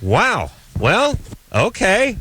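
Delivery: slow, then rising high in disbelief; mock disbelief. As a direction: play it stunned, pitch climbing. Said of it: "And I was like".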